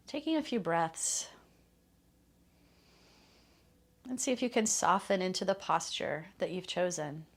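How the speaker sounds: calm, unhurried meditation voice with long pauses; guided meditation. Real, not acted. Teacher settling listeners into a loving kindness practice, guided meditation. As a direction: ease listeners gently into stillness, leaving space between phrases.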